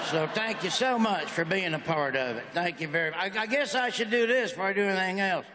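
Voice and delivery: ordinary delivery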